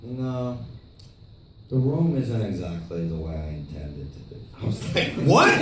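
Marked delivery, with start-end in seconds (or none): 0.0-4.4 low, soft imitated confession; lightly voiced filmmaker, subdued; Tommy. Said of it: "he's like". 5.1-5.6 high, loud retold "what?"; incredulous yelp, acted out; shocked disbelief. Acting out shock at Tommy's admission.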